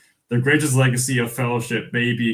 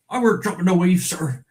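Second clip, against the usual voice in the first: put-on country accent; 7 semitones higher than usual. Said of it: "nailing that kind of country accent".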